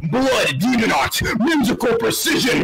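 hammed-up solemn anime incantation; mock anime attack call. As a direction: intone it like a dramatic special move. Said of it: "You said".

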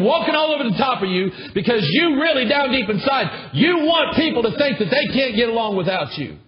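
high, loud, driving sermon delivery; preaching at full force. Acted. Preacher rebuking the church for wanting to be needed, fiery. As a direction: pound the rebuke into the congregation.

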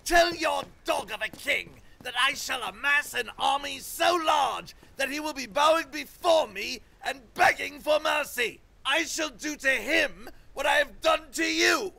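high raving shout; raving king. Acted. King rages at fleeing messengers, high raving shout. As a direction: rave after them as they flee. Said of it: "raving at them as they ran away".

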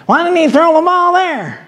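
high mocking critic's whine; mock a know-it-all fan yelling at the TV; armchair quarterback. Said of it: "A lot of armchair quarterbacks".

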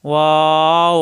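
ওয়াও stretched long, rounded and open; lips kept apart. Shown as a demonstration. Teacher showing learners the lip gap, rounded waw. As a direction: hold ওয়াও with lips never touching. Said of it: "দুই ঠোঁট ফাঁকা থাকবে".

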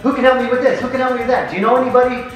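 firing off eager requests for help; eager asking around. Retold or imitated. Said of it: "I just started asking anybody".

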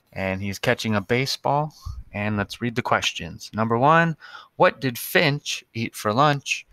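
normal talking voice

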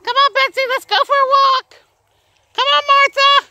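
very high calling voice; calling the birds to come for a walk; high call to pets. Real, not acted. Calling the animals.

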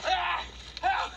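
high shouted cries for Ashley; calling for help. Real, not acted. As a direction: yell her name over and over.

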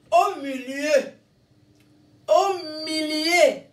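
drawn-out, triumphant call; jubilant. Retold or imitated. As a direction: relive the jubilation, stretching the words out. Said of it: "je jubilais quand je faisais ça".

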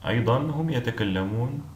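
ordinary talk

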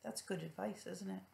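gentle hushed comment; soft aside. Real, not acted. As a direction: quietly point out the picture. Aside to child.

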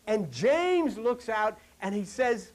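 normal talking voice

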